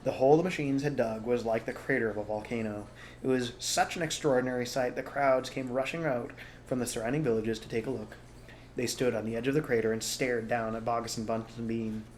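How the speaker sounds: ordinary delivery, nothing marked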